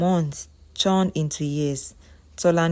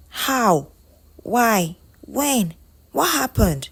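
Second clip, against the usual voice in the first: raised, disbelieving questions, one by one; shocked questioning; 5 semitones higher, 3 dB louder, slower than usual. Acted. Ego learning that Tolani can't see, shocked. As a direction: separate startled questions, climbing in pitch. Friend shocked by bad news.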